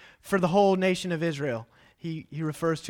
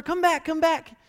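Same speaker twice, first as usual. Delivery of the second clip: high, louder call for Tater; calling out across the woods; calling the dog; 11 semitones higher, 4 dB louder than usual. Retold or imitated. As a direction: shout the dog's name to bring her back. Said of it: "we're calling her".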